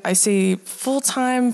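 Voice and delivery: normal talking voice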